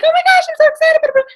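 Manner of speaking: squealed high and loud, gushing; mock squeal of excitement; over-the-top gushing. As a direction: gush like the overexcited type you don't have to be. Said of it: "that's like".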